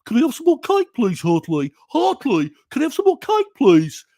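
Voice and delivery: high, loud West Midlands whine; exaggerated high Brummie pig; Pig from Pipkins. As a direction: plead like a puppet pig with a Brummie accent. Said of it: "I can do the pig accent".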